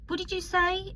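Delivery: high-pitched mock query; imitating bullies. Bullies picking on her accent at school, high mock query. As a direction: put on a high voice, feigning not to understand. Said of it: "they would pick up on my accent".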